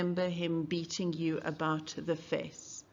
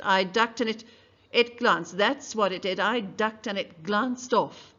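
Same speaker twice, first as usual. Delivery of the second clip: insistent witness; 2 semitones higher, 6 dB louder than usual. Acted. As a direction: dig in and repeat it loudly. Mayella insisting on her story.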